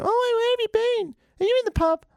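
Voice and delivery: very high mock nagging; moaning partner. Moaning partner asking if you were in the pub, high nagging. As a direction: nag in a squealing high falsetto. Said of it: "If your partner's like".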